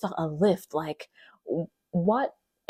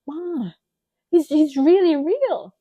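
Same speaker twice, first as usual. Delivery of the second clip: earnest plea; 10 semitones higher, 7 dB louder, slower than usual. Retold or imitated. Insisting to her mother.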